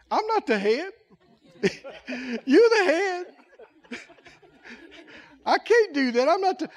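a hint of crying worker; mock whiny refusal. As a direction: play the timid employee begging off. Rob crying, refusing the job.

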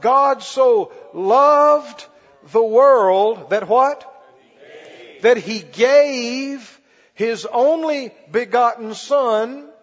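slow, loud, drawn-out scripture recital; preaching scripture. Acted. Preacher leading the church through John 3:16, slow and loud. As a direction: stretch each word like a pulpit proclamation.

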